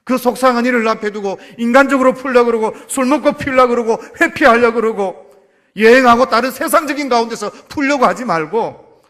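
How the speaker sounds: loud, urgent preaching to the church; full-force preaching. Acted. Pastor exhorting the congregation to pray, loud preaching. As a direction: drive it home from the pulpit.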